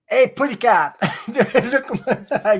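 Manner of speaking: playful high greeting. Real, not acted. Playfully showing cat ear.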